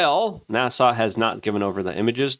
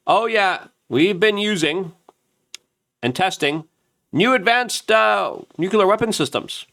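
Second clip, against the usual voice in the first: higher, smug boasting voice; mock bragging Russians; 9 semitones higher than usual. Russians bragging about new weapons, smug higher boast. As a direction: gloat like a proud Russian official. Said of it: "the Russians have been kind of bragging".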